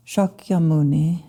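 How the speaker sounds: ordinary talk, nothing marked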